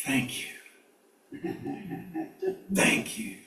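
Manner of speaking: hushed, breathless thank you; awed whisper. Retold or imitated. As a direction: whisper it in awe to the owl. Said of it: "what she's saying is".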